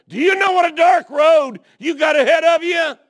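loud, high-pitched preacher's shout; full-force preaching. Real, not acted. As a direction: shout it at the congregation, high and hard.

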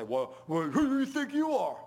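high, loud, indignant sass; hammed-up indignant comeback; mock defiant retort. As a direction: play the cheeky sinner talking back. Mock sassing the Lord.

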